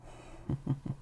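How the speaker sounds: breathy sinister little laugh; a quiet, amused villain's chuckle; soft dark chuckle. Acted. Captor laughs before starting.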